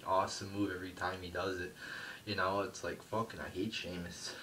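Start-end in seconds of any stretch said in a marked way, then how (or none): none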